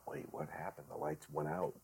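very soft, puzzled inner voice; hushed confusion. Retold or imitated. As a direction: murmur a bewildered thought to yourself. Retold startled thought in darkened theater.

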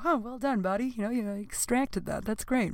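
lightly voiced proud praise; mock praise to the poop. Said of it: "you go".

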